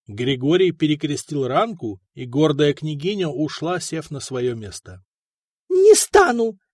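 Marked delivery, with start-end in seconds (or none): 5.7-6.6 high, loud shrieking refusal; shrill shriek. Acted. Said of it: "визгливо вскрикнула Людмила".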